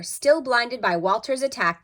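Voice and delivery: usual speaking voice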